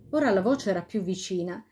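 plain reading voice

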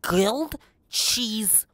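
drawn-out, delighted announcement; excited. Real, not acted. Excited reveal of the dish.

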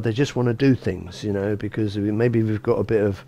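ordinary talk

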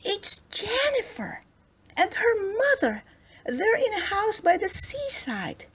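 very high-pitched excited teddy; high, breathless-with-excitement character voice; excited cry. A narrator's reading. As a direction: exclaim it high, thrilled at the discovery. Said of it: "cried Ernest".

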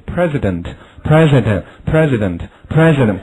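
slow repeated model word; slow drill reading. Shown as a demonstration. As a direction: give "president" slowly four times. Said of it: "这个词".

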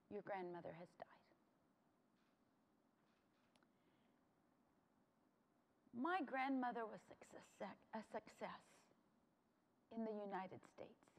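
very soft, subdued and solemn; hushed grief. Real, not acted. Granddaughter recounts her grandmother's death, hushed and sorrowful. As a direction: let the voice drop away with long pauses.

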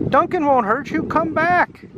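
high, pleading call; crying out. Acted. Children telling villagers Duncan is harmless, crying out. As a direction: call them back in a high voice. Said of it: "cried the others".

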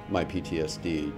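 ordinary delivery

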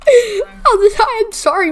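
high, helpless laughing apology. Real, not acted. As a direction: apologise through real laughter. Laughing while apologising to Mario.

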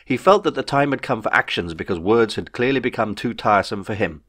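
plain reading voice

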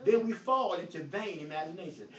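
usual speaking voice

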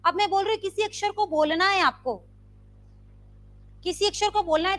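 normal talking voice

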